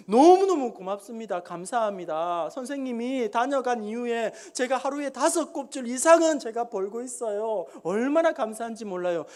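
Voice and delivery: beggar gushing thanks to a benefactor; acted overjoyed thankfulness; effusive gratitude.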